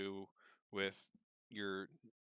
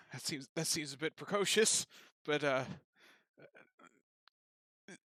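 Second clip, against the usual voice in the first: high-pitched nervous stammer; flustered, stalling for time; nervous noble; 7 semitones higher, 3 dB louder than usual. Acted. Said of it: "He's like".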